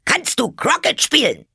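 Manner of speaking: high, loud imperious yell; screamed question. A narrator's reading. Queen questioning Alice about croquet, screaming. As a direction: bellow the question at top pitch. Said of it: "schrie die Königin".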